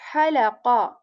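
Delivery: halaqa begun with breath pushed from mid throat; halaqa with airy ha. Shown as a demonstration. Teacher contrasting the airy word. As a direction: push air first, then halaqa. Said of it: "we say".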